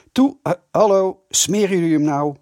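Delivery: loud, impatient call; discourteous shout. A narrator's reading. Kees hurries the girls out, calling none too politely. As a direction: holler rudely through the door. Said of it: "niet erg hoffelijk".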